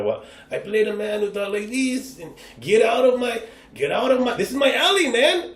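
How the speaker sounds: Mexican accent. Shown as a demonstration. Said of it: "a Mexican accent".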